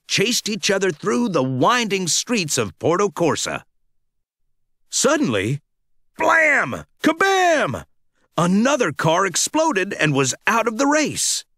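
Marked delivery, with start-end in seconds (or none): 6.2-7.8 high, punchy blam and kabam; mock explosion sounds. Reading the race crash, voicing booms. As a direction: burst out each boom like a blast. Explosions in read-aloud story.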